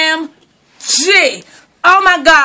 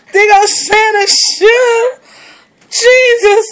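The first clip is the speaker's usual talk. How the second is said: high laughing wail; half laugh half wail; laughing cry; 5 semitones higher, slower than usual. Real, not acted. Owner cracking up over Santa's shoe.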